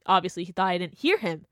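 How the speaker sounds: normal talking voice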